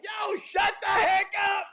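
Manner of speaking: high, slow yell telling him to shut up; annoyed shout. Retold or imitated. New Yorkers yelling at the happy guy, loud annoyed shout. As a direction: bark it across the street. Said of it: "were just cussing him out".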